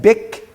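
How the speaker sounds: "bik" as one short syllable shut by the k; closed syllable. Shown as a demonstration. Teacher showing how a shua closes it, "bik" as one syllable. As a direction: close "bik" straight onto the k. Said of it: "So you pronounce that".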